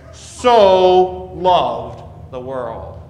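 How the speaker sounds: "so" drawn out slow and loud; stress on so. Shown as a demonstration. Preacher dwelling on "so" to the congregation, slow and heavy. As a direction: lean hard on "so", stretching it. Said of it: "John stresses the word so".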